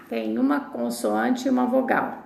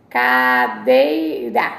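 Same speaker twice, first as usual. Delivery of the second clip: Cadeira drawn out slowly, much lower; 11 semitones lower, 4 dB louder, much slower than usual. Shown as a demonstration. Teacher to class, modelling cadeira slowly. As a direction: stretch Cadeira low and slow. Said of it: "como eu falo".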